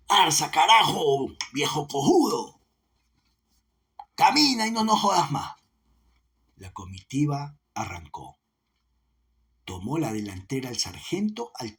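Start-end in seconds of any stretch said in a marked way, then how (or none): 0.1-5.5 angry bellow. A narrator's reading. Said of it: "vociferó con cólera".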